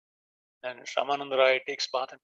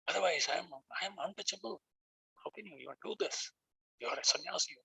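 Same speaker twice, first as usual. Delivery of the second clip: humble hush; 4 semitones higher, 8 dB quieter, faster than usual. Retold or imitated. Ramananda humbly refusing the touch.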